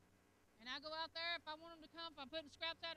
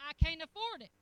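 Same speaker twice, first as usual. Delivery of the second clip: much louder whine; loud mock complaint; 13 dB louder than usual. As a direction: wail it like a broke man. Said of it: "keep calling the".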